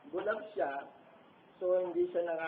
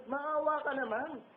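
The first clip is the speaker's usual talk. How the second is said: higher and slow, begging; pleading cry; 9 semitones higher, 5 dB louder than usual. Retold or imitated. Preacher voicing a begging prayer, higher and slow. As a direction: stretch out the plea for mercy. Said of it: "Yun ang mga sigaw natin".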